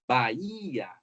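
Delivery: Bahia, demonstrating the strong second-to-last syllable; stress on penultimate syllable. Shown as a demonstration. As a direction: stretch the HI in Bahia. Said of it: "o acento recai na penúltima sílaba".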